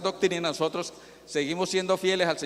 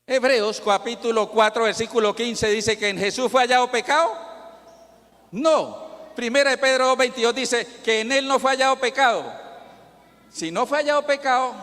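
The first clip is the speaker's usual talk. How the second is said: loud, higher pulpit proclamation; full-force preaching; 5 semitones higher, 5 dB louder than usual. Acted. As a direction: hammer the point home to the congregation. Climax of the sermon argument. (predicación a toda voz)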